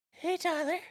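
a sweet mom surprising her son, high; mom's greeting. Retold or imitated. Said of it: "she's like".

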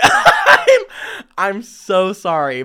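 high giggle into a sorry; giggling sorry; laughing apology. Real, not acted. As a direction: apologise through real laughter.

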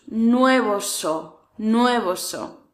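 "Nuevos o" stretched slowly, falling; slow drill. Shown as a demonstration. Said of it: "Nuevos o antiguos".

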